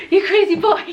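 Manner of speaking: high, loud doting voice; high puppy talk. Real, not acted. Owner to her wild puppy, high and doting. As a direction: coo it up high at the dog.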